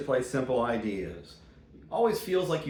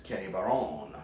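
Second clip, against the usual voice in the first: French pronunciation; 6 semitones lower, 6 dB quieter than usual. Shown as a demonstration. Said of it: "as he's known in France".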